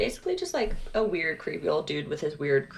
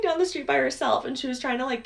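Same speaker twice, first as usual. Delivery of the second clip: normal talking voice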